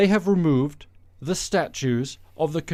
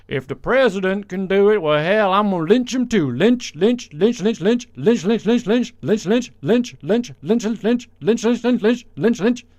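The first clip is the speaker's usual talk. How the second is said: higher, louder hammed-up Southern rant; mock Southern politician; 5 semitones higher, 4 dB louder than usual. Host mocks a politician blurting out before thinking, frenzied Southern caricature. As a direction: run away into a frantic, repetitive high chant.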